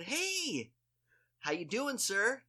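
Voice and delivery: high, drawn-out hey with a casual sir; hearty greeting. Retold or imitated. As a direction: retell an overly breezy greeting, pitched high. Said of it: "and I just said".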